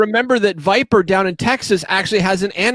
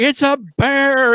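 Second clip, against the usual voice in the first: retold alarm; 7 semitones higher, slower than usual. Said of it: "he started going".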